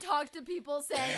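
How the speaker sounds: normal talking voice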